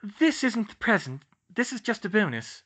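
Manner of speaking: put-on high, playful girl voice; young woman voice.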